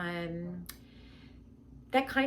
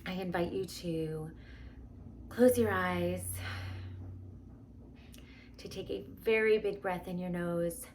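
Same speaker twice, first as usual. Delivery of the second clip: guided meditation; 3 semitones lower, 8 dB quieter than usual. Acted. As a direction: guide them gently into calm.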